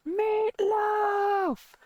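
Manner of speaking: loud high call across the house, both words drawn out; yelling for mom. Retold or imitated. Grown kid yelling out the door to mom, loud call. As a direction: shout it like calling someone from another room. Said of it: "I just yelled".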